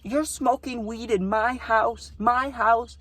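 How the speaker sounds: high, slow mock-outraged girl voice; mock angry girl voice; imitating mad girl. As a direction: whine it like an offended host. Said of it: "And she's like".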